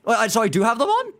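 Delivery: high, loud, flustered questions; loud high-pitched disbelief; startled confusion. Real, not acted. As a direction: blurt it out in alarmed surprise. Startled by voices setting.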